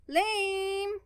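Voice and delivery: high, loud scornful jeer; booing. A narrator's reading. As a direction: sneer it like a boo. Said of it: "Gwen booed".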